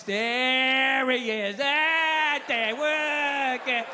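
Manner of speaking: very drawn-out, held vowels, hammed-up; mock spray shooter. Comic mocking people who suck at shooting, drawn-out held yell. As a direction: stretch each word out as if through continuous firing. Mimics bad shot spraying bullets.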